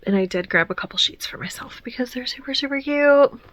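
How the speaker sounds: guilty little whisper, rising at the end. Real, not acted. Vlogger admitting she kept cute paper, whispered. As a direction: whisper it like a small confession.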